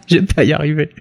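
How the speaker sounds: cracking up mid-sentence; can't stop giggling; laughing. Real, not acted. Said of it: "je peux plus maintenant".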